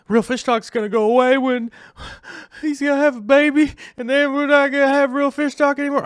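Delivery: high, loud whiny-fan impression; mock worried fans. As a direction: mimic fretting viewers in a loud, high squeal. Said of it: "some people were like".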